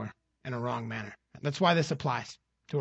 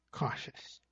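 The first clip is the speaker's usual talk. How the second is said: whispered warning; 8 dB quieter than usual. Acted. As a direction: drop to a breathy whisper on the last word. Warning stressed by dropping to whisper.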